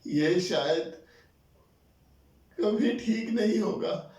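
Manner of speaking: high, slow, tearful father; acted crying. Father giving up hope before child, acted crying. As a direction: break down in tears, drawing it out high. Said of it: "बाबा को पहली बार ऐसे रोते हुए देखा".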